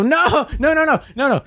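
high-pitched rapid string of refusals; comic wail of dread; mock panic. Driver about to be put through to scammers, mock panic.

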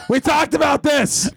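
high, drawn-out scolding yell; mad yelling. Retold or imitated. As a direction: scold a mouse at full pitch. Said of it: "So I get very mad at the mouse".

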